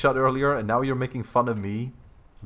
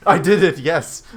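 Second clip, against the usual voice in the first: much louder and higher, bursting with laughter; laughing lie; 6 semitones higher, 9 dB louder than usual. Real, not acted. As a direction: try to lie but crack up. Laughing while lying on phone.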